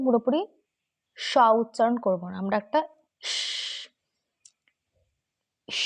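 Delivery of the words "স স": স as a sharp English S, not শ; English S demo. Shown as a demonstration. Teacher demonstrating the English S sound to students. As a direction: hiss the S cleanly. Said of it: "এইভাবে, স".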